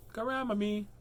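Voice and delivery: lifted, playful "Grandma me"; high-pitched repeat. Pretend. Playing with the word grandma.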